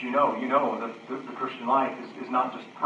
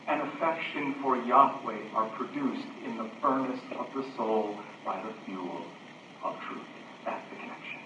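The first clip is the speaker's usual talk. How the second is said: hushed preaching; 5 semitones lower, 6 dB quieter than usual. Acted. Preacher explains the fuel of worship, hushed preaching. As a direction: drop low and soft.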